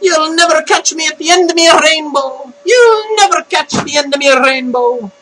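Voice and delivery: high, lilting cartoon Irish taunt; mock Irish voice. Rainbow character taunting a pursuer, mock Irish. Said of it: "I can't do the Irish voice".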